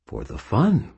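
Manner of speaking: lilting rise on fun; sing-song rise on fun; high rising thought. Acted. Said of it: "I thought to myself".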